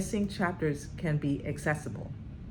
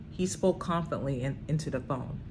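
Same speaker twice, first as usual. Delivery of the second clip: normal talking voice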